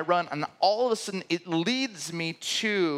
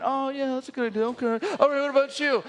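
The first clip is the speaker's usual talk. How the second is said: higher, breezy mock politeness; mock chirpy host; 7 semitones higher, faster than usual. Mocking the Midwest dinner guests, higher chirpy voice. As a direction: send up the cheerful meeting leader.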